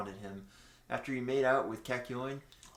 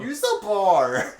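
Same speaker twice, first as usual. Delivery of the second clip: slow, much higher, louder laughing; 9 semitones higher, 12 dB louder, much slower than usual. Real, not acted. Friend laughing at the mouse trouble, loud and high. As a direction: laugh out the advice. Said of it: "mouse complaint".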